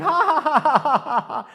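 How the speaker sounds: high loud jeering cackle; mocking laughter. Pastor imitates the kids laughing at Charlie Brown, loud jeering cackle. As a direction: laugh at him like the cruel kids.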